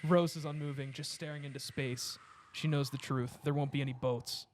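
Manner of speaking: ordinary talk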